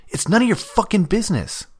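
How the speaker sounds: high, loud angry snap; incredulous outburst. Acted. Damien snapping at Scratch, outraged she even asked. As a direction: blurt it in outraged disbelief. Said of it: "incredulous that she had even asked".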